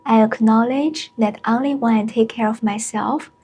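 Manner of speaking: unhurried meditation guide with long pauses; spacious, measured mindfulness voice; guided meditation. Acted. Leads a short mindfulness meditation.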